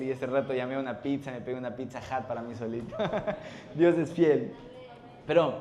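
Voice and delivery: usual speaking voice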